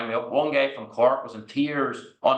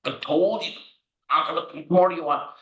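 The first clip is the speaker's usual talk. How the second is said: exasperated; 5 semitones higher than usual. Retold or imitated. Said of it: "You basically go".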